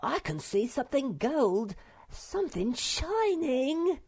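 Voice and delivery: high little voice rising with excitement; greedy, high-pitched delight; eager wonder. A narrator's reading. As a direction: squeal it, stretching the last words. Sniff spots gold in wreck.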